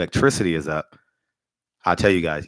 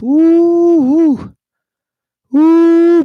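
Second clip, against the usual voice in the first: long, loud, very high "woo"; high-pitched sustained whooping; gleeful whoop; 9 dB louder, much slower than usual. Real, not acted. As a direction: let out big falsetto whoops. Whoops at thought of him losing.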